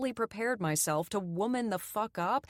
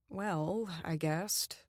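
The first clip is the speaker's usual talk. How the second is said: ordinary delivery, nothing marked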